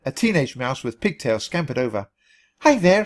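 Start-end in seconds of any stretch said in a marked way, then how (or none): 2.6-3.0 high, loud bright greeting; chirped. A narrator's reading. Teen Susie greeting Stilton, chirpy hello. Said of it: "she chirped".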